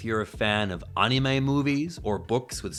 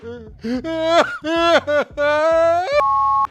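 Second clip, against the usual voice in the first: high, loud burst of laughter; 13 semitones higher, 5 dB louder than usual. Real, not acted. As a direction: laugh it off loudly. Laughter after outburst.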